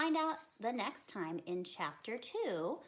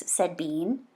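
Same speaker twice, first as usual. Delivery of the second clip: put-on lively, louder read-aloud for kids; story reading; 18 dB louder than usual. Reads the chapter aloud.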